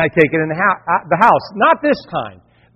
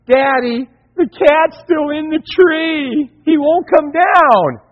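put-on high, slow little girl on the phone; worried daughter; 9 semitones higher, slower than usual. Daughter phones dad, high and worried. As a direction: sound like a fretting child. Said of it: "It's my daughter".

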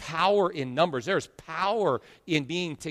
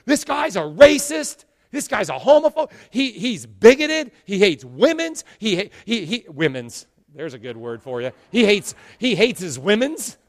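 lightly voiced outraged pundit; mocking the media; 4 semitones higher, 7 dB louder than usual. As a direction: parody the hysterical media. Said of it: "They're like".

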